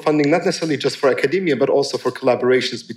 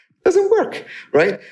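"doesn't" shot up very high in mock outrage; outraged; slower than usual. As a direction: squeal "It doesn't work" in disbelief. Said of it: "they seem to have the attitude".

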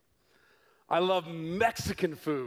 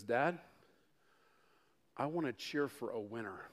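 quiet; 7 semitones lower, 9 dB quieter than usual. Retold or imitated. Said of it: "he says".

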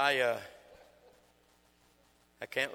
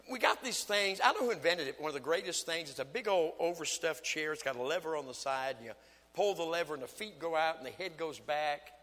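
preaching; 6 semitones higher, 9 dB louder than usual. Acted. Preaching at full force.

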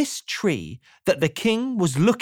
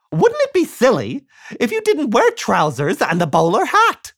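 louder, higher cheery silly voice; Mister Silly voice; 8 semitones higher, 5 dB louder than usual. Acted. Mister Silly quizzing the chicken, loud and cheery. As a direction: ask it brightly, rising to the umbrella. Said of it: "he said to the pig".

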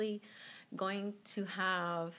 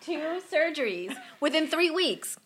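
amazed; 8 semitones higher, 8 dB louder than usual. Retold or imitated.

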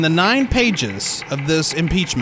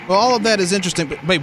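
usual speaking voice

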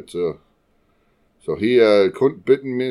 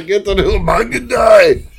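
high-pitched Austrian action-hero cry; hammed-up Austrian movie catchphrase; Schwarzenegger impression; 6 semitones higher than usual. Co-host repeating the joke as mock Schwarzenegger.

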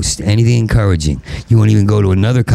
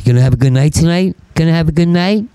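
mocking clubgoer; 9 semitones higher than usual.

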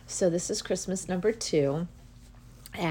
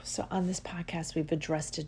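whisper; 4 dB quieter than usual. Real, not acted. Calm recap after deep breath.

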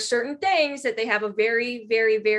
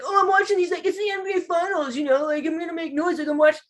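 high-pitched mocking imitation of an obnoxious bar guy; mock heckler; 6 semitones higher than usual. Bar regular protesting to trivia players who shushed him, mocking imitation. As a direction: imitate him whining back, pitched well up.

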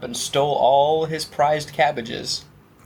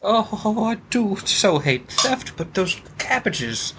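higher, slow put-on genteel accent; posh mock accent; 4 semitones higher, slower than usual. Thief eyeing the cabbages, in a slow posh put-on accent. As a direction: drawl it like a fussy aristocrat. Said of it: "my accent has changed".

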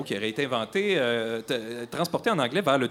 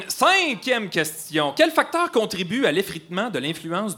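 quiz announcer; 6 semitones higher than usual. Acted. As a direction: draw out the question number like a host. Quiz host announcing next question.

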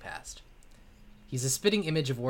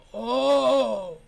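high, drawn-out ghostly wail; 11 semitones higher, 7 dB louder, much slower than usual. Acted. Old ghost wails.

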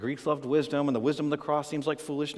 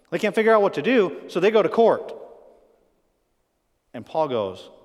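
usual speaking voice

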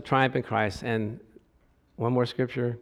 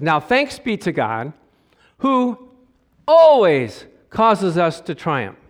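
slow scripture reading; 2 semitones higher, 5 dB louder, slower than usual. Acted.

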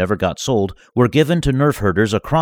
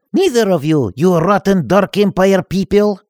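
much higher, louder insult; defiant nerd; 12 semitones higher, 5 dB louder than usual. Acted. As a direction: insult them cheekily. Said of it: "Vasily said and laughed".

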